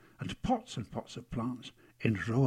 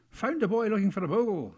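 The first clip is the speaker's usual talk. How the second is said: high, loud character voice; cheery high-voiced garden worker; Fred; 8 semitones higher, 4 dB louder than usual. A narrator's reading. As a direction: announce a newcomer, pitched well up. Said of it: "said Fred".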